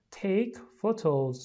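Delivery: slow teacher model. Shown as a demonstration. Teacher models the phrase for the class, slow and clear. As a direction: Take photos stretched slowly word by word. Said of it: "لطفاً تکرار کنید".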